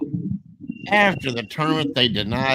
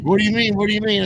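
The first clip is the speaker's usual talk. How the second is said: startled denial; 9 semitones higher, faster than usual. Retold or imitated. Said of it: "He's like".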